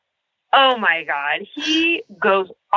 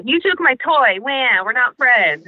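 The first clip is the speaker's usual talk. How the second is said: put-on childish pout; 7 semitones higher than usual. Mocking Dawson as a petulant child.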